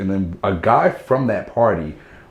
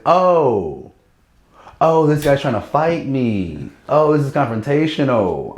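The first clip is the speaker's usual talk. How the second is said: dawning surprise; 5 semitones higher, slower than usual. Retold or imitated. Sudden realization of a fight.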